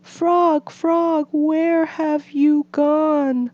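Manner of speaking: high, slow, worried calling; lost Toad. A narrator's reading. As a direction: call into the dark, slow and high. Toad searching in the dream.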